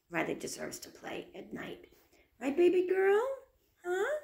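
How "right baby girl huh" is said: high, sing-song cooing to a little one. Real, not acted. Mother calling her baby to look at the camera, high cooing. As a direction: coo up high to get her attention.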